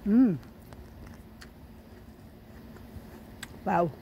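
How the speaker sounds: talking through a mouthful of apple; mouth full. Real, not acted. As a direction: cheeks stuffed full, chewing. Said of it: "My mouth is full of apple".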